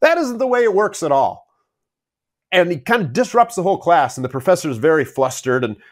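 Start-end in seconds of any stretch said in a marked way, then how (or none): 0.0-1.4 higher-pitched mock outburst; retold outburst. Retelling Dangerfield's frustrated character interrupting a lecture, mock outburst. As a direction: burst out in frustration, retelling a movie scene. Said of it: "he bursts out and says".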